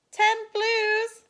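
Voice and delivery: high, loud excited call; boy calling out. A narrator's reading. Boy announces his count to the class, high loud call. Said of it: "said a boy in blue".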